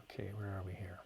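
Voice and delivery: low whispered aside; muttering to self. Real, not acted. Host hunting for his place in viewer questions, whispered muttering. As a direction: whisper it under your breath. Talks to himself while finding next question.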